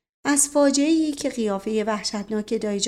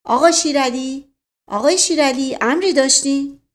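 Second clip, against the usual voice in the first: louder call out a window to the yard; raised voice calling down to the courtyard; 4 semitones higher, 6 dB louder than usual. A narrator's reading. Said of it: "صدا زد".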